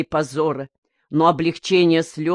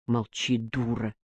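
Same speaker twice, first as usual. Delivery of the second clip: low, soft shushing; hushed rebuke; 7 semitones lower, 10 dB quieter than usual. A narrator's reading. Another old woman silencing the gossip, hushed rebuke. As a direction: quietly put her in her place. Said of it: "остудила её другая".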